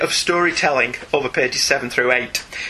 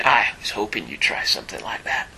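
quiet Wolverine line; 6 semitones lower, 5 dB quieter than usual. Retold or imitated.

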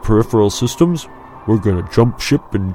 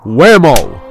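loud, punched exclamation; emphatic slam; 4 semitones higher, 8 dB louder than usual. A narrator's reading. Friend acting out the crash for Luke, emphatic slam. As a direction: hit it like a sudden crash. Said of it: "He slammed one fist violently".

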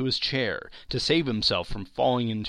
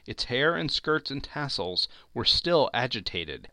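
usual speaking voice

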